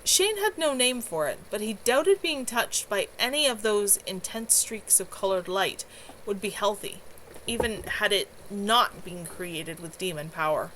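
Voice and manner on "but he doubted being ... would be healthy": plain reading voice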